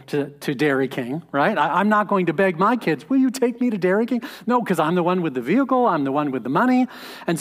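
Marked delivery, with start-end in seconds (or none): 3.1-4.2 mock begging child. Pastor mimics a child begging.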